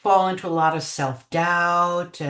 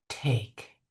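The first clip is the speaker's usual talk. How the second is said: gracious soft offer; 6 semitones lower, 7 dB quieter than usual. Retold or imitated. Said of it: "so graciously, lovingly".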